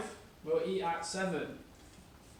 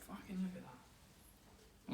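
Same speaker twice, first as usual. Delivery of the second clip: awed whisper; 2 semitones higher, 15 dB quieter, much slower than usual. Real, not acted. Amazed at the finished dish.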